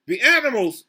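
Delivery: loud storybook yell. Acted. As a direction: shout it out in the story. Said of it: "yelled Mister Payne".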